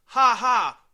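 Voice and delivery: loud mocking laugh then yell; gloating shout. Real, not acted. Gloating at complaining fans.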